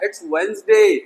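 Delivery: "Wednesday" said as वेन्सडे; correct pronunciation. Shown as a demonstration. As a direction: silent first D in "Wednesday". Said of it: "don't do that". (सही उच्चारण, Wednesday को "वेन्सडे")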